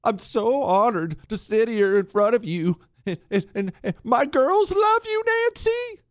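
high, cracking fake-tearful gush; mock choked-up speech. Imagined speaker tearfully honoring a rival, in a sarcastic weepy voice. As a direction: overdo the weepy tribute for laughs. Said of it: "get all ch-, ch-, choked up at the honor".

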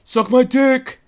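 loud crude yell; shout. Retold or imitated. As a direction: bark it out rudely. Quoting rude tweet reply.

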